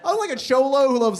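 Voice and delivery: ordinary delivery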